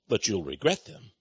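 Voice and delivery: normal talking voice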